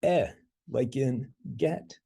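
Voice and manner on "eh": "Eh" alone, the vowel in get; pronounced eh. Shown as a demonstration. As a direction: say a short open "Eh". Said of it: "It's pronounced eh".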